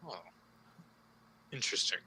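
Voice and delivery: a hint of a cool, intrigued cult leader; robed villain. Robed man looks at the intruder, quietly intrigued.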